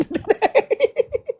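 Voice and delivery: real high-pitched giggle; high laugh. Real, not acted. Amused by the rant.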